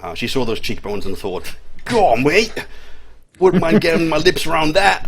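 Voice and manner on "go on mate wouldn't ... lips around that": loud, high-pitched bad Australian accent; terrible Aussie accent. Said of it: "that's my terrible Aussie accent".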